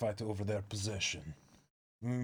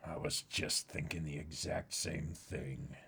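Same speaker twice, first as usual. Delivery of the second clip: much lower and softer, a worried, solemn figure; a deep, hushed voice weighing a desperate plan; low, grave character voice; 7 semitones lower, 7 dB quieter than usual. Acted. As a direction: speak low and grim about a hopeless mission.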